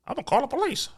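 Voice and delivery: high, soft, put-on threat; mock whiny customer. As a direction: imitate a petulant customer threatening to report. Mimicking a complaining customer.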